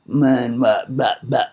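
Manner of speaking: mock the put-on tough-guy talk; mock gangster talk. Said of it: "Full of 'em talking all fucking".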